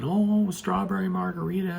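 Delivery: dreamy high voice of mom longing for a drink; mimicking mom. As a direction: imitate a mother craving a margarita, pitched high. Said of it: "she keeps saying".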